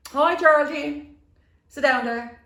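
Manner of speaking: high, loud, drawn-out posh greeting; posh put-on voice. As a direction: mimic a grandly posh hostess. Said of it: "she'd be talking posh or something".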